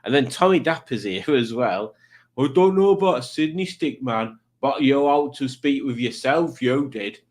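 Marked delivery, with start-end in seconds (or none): 2.4-7.2 hammed-up Brummie accent; terrible Brummie accent. Streamer reading Tommy's comment, in a terrible Brummie accent. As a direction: read it in a bad Birmingham accent. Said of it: "in my terrible Brummie accent".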